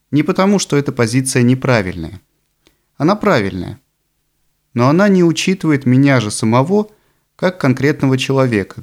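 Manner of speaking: ordinary talk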